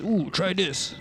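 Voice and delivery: a hint of eager orc. Orc offering the brigand a sip, in orc voice. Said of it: "The orc next to me says".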